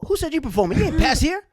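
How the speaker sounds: high, loud mock challenge from the door guy; high loud doorman turning them away; mock gatekeeper. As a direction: bark it like a doorman blocking you. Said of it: "And Quentin goes".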